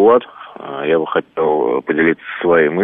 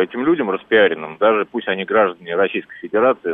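ordinary delivery

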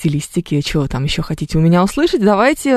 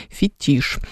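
"Фетиш" with a lower, stressed final -тиш; stress on second syllable; 4 semitones lower, 4 dB quieter than usual. Shown as a demonstration. Host confirming the French word, "Фетиш" with end stress. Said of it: "что как нужно ударять".

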